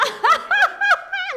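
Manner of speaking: high, loud burst of laughter; long hearty cackle; loud belly laugh. Real, not acted. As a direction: crack up at a crude joke. Laughing at toilet nickname.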